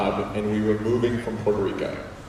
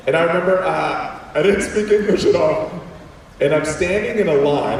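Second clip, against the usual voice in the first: ordinary talk, nothing marked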